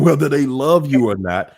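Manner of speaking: ordinary talk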